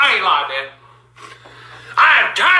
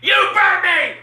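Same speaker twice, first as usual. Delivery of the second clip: angry accusing shout; real furious protest; outraged yell; slower than usual. Real, not acted. Repeating the yelled accusation.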